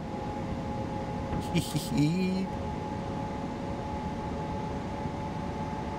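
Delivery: quiet breath of a giggle. Real, not acted. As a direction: giggle softly to yourself. Delighted by the train ride.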